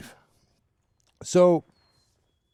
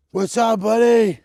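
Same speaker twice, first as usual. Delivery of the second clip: doggy talk; 9 semitones higher, 9 dB louder than usual. Real, not acted. Greeting the neighbor's dog.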